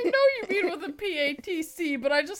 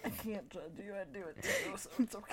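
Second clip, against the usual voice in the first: soft and hushed; quiet; 6 semitones lower, 13 dB quieter than usual. Real, not acted. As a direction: murmur it gently. Soft consoling aside.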